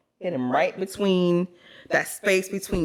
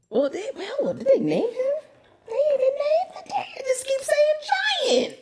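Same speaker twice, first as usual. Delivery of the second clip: squeaky high-pitched disbelief; high surprised squeal. Real, not acted. Friend finds giant unnamed, squeals in high surprise. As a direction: shriek in amazed disbelief.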